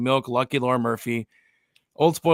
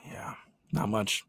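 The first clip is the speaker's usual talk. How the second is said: whispering; 2 semitones lower, 9 dB quieter than usual. Real, not acted. Sighs out a short answer.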